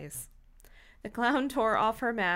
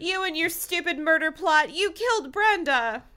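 high, louder melodramatic blame; furious accusation; 8 semitones higher, 5 dB louder than usual. Acted. Dina blames Tracy for killing Brenda, furious accusation. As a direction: accuse her in acted outrage. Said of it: "Her eyes were narrowed with fury".

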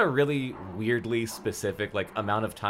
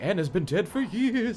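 spooky voice; 11 semitones higher than usual. Retold or imitated. Creepy line of spooky phone story.

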